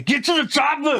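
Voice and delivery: high, hammed-up action-movie yell; movie action hero. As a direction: shout it like a famous film line. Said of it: "I'm waiting for him to say".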